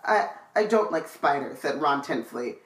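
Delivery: low, even read-aloud voice; story narration. A narrator's reading. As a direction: read the chapter out steadily. Reads the book aloud.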